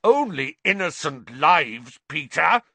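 fury. A narrator's reading. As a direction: hit him hard with the answer. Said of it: "with a terrible fury".

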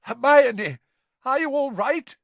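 high, loud, anxious check; alarmed father's concern; shaky voice. A narrator's reading. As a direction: call out worriedly to them. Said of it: "His voice sounded shaky".

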